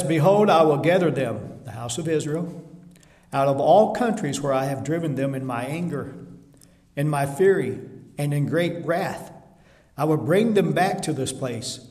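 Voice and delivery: loud, ringing preaching of the verse; forceful scripture reading. Acted. Preacher booming God's promise to the church. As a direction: proclaim it to the congregation.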